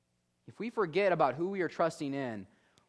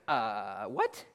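baffled reaction; 8 semitones lower than usual. Acted. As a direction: drop low, then jump up in disbelief. Disciples' baffled reaction imagined.

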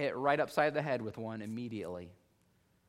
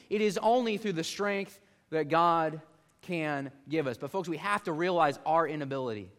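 usual speaking voice

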